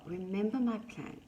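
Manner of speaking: ordinary delivery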